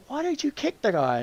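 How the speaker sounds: puzzled high query; dad's questioning. Retold or imitated. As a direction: imitate an incredulous parent, voice lifted high. Dad questions him in story.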